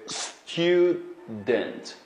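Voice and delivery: student, drawn out slowly for the class; slow classroom model; slow model word. Shown as a demonstration. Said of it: "teacher models the word".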